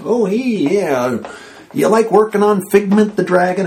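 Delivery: lazy friendly stoner greeting. Retold or imitated. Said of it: "she kinda talked like a stoner".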